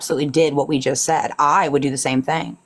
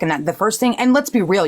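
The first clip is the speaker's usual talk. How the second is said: ordinary talk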